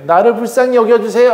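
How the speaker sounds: high, imploring petition; pleading prayer. Acted. Leah prays to God for pity, high pleading. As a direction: beg for pity, voice lifted high. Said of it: "하고 기도했던 게".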